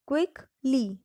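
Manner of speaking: "Quickly" broken in two, a pause before "ली"; split into word parts. Shown as a demonstration. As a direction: say "quickly" as two separate pieces, quick, then ली. Teacher splits word into root, suffix.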